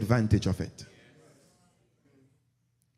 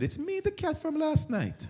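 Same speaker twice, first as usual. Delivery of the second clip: high laughing silly voice; dumb thief; 4 dB quieter than usual. Acted. Foolish thief answers the guard, high and laughing. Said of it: "And the man said".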